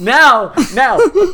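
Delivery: shrieked high, loud No; mock-outraged shriek of protest; shaking with anger. Real, not acted. Outraged at the rating.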